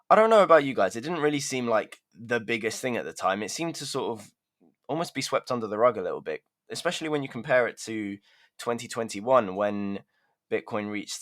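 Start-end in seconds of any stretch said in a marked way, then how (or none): none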